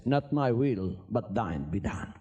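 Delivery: low, slow, solemn prayer of surrender; solemn Jesus. Acted. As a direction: lay the words down slow and deep like a vow. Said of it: "Jesus said".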